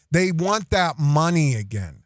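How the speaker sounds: ordinary delivery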